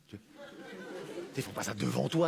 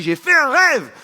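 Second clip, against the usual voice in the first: preacher parody; 11 semitones higher, 11 dB louder, slower than usual. Acted. Parody of King speech.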